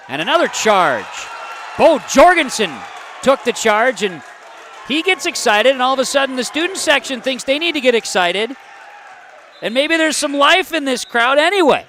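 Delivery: high, loud, excited play-by-play; worked-up commentary. Real, not acted. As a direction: get carried away by the big call. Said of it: "I shouldn't get too excited".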